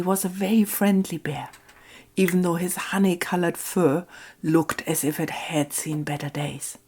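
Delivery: soft, hushed bedtime storytelling voice; calm, intimate storyteller reading to children; story narration. A narrator's reading. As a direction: read it gently like a cozy fairy tale. Reads fairy tale to viewers.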